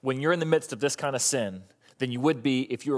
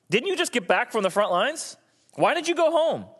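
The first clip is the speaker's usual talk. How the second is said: incredulous question; 7 semitones higher than usual. Retold or imitated. David questions Uriah.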